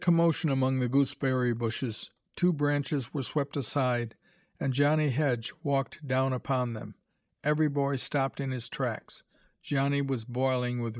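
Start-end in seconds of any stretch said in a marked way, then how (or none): none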